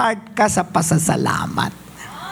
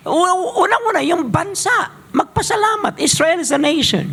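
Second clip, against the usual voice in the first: higher impassioned preaching; 8 semitones higher than usual. Acted.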